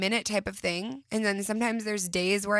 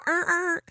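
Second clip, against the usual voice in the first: imitated strained noise; 9 semitones higher, 4 dB louder than usual. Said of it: "what if you gave it a sound".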